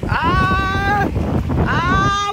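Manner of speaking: sudden high scream of shock; startled scream. Real, not acted. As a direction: yelp in real surprise.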